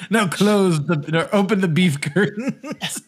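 slow, loud, drawn-out showtune-style delivery, breaking into laughter; mock Broadway showman. Riffing on a Broadway show with a friend, hammy showman. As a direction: belt it out slowly like a hammed-up stage number.

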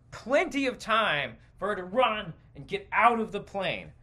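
ordinary delivery

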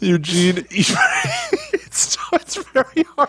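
real laughing fit, voice high; helpless laughter. Real, not acted. Host losing it over the silly name, laughing. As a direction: laugh so hard you can hardly speak.

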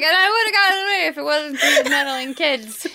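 cartoon villain. Host voicing her cat as a Scooby-Doo villain, laughing. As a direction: deliver the caught-villain line half laughing. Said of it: "He's like".

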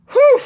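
loud high exhale of relief; relieved whew. Real, not acted. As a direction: blow out a big whew. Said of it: "That was, that was wild, man".

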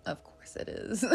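a sly whisper in a put-on accent. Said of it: "That is not my natural accent".

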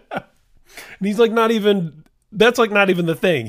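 high and loud, laughing through the words; bursting with delighted laughter; can hardly believe the story, laughing it out; laughing excitedly. Real, not acted. Laughing at the [name] reveal.

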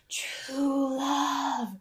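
breathy whispered strain, drawn out; long, airless whisper; half-dead croak. Retold or imitated. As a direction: wheeze it out like a man almost dead. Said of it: "and he goes".

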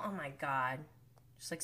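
usual speaking voice

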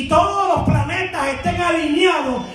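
high, slow, emphatic pulpit shout; full-force preaching. Real, not acted. Preacher warning those who wait for perfect timing, full-force preaching. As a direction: stretch each phrase, preaching hard.

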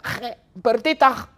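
high, drawn-out yell; slow, high-pitched exasperated shout; Jerry shouting. Acted. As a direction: call out the name in exasperation. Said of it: "le grita".

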